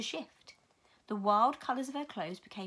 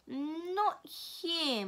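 eerie voice; 7 semitones higher than usual. Acted. Mrs Which speaking.

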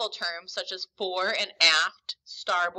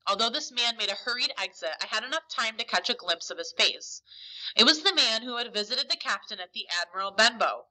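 plain reading voice

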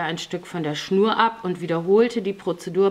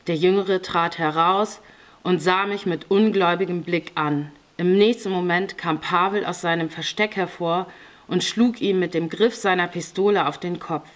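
usual speaking voice

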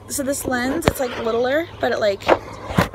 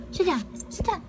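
talking to a dog; 5 semitones higher, 6 dB quieter, faster than usual. Real, not acted. Commanding dog, rising.

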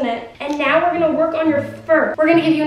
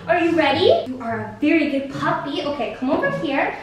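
normal talking voice

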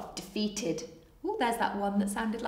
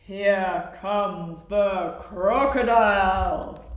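slow, louder, stretched-out announcement; dramatic title read; 7 dB louder, much slower than usual. Pretend. Storyteller announcing the crocodile's arrival, dramatic slow warning. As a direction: draw the title out with menace.

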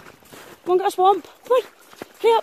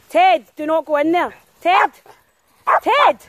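calling out; 3 semitones higher, 5 dB louder than usual. Real, not acted. Owner calling her dog away from the mud, rising calls. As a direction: call the dog back, getting sharper.

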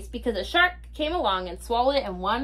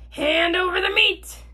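pirate demand; 7 semitones higher than usual. Acted.

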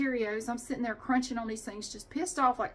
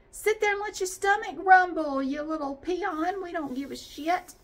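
higher, louder sneering boss; mock boss voice; 7 semitones higher, 5 dB louder than usual. Imitating bosses sneering at hungry staff, mock snooty voice. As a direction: sneer down at the little peon. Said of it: "Did they do that this year?".

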